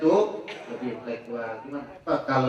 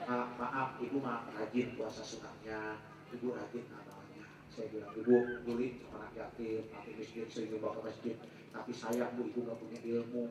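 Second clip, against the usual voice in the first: low, hushed and apologetic retelling; quiet, low-pitched gentle correction; soft admonishing; 5 semitones lower, 10 dB quieter than usual. Retold or imitated. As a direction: break it to her gently, very softly. Retold soft admonishing of the woman.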